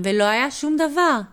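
plain reading voice

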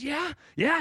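perked-up, high-pitched reaction; excited. Retold or imitated. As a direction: imitate a friend suddenly perking up, high. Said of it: "perked up and got all excited".

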